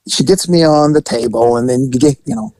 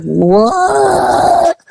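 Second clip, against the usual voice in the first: confused masseuse. Retold or imitated.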